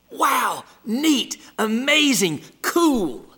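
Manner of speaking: high, imitated childlike wonder; kids' amazement. Kids responding to the crawdad, imitated amazement. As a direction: echo impressed kids, each word a separate exclamation. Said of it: "but the kids' response".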